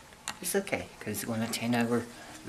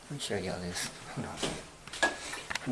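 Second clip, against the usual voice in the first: whispering; 4 semitones lower, 8 dB quieter, slower than usual. Real, not acted. Whispered aside at night.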